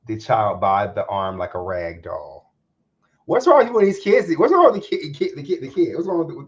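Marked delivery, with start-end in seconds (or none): 3.2-6.5 incredulous outburst. Real, not acted. Exclaims over the absurd news story.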